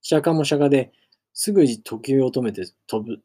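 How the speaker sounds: ordinary delivery, nothing marked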